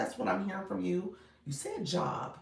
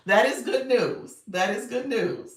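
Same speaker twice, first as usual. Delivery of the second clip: loud, slow, delighted announcement; excited praise; 5 semitones higher, 8 dB louder, slower than usual. Real, not acted. Host celebrating a viewer's live access, loud and slow. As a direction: cheer the news out.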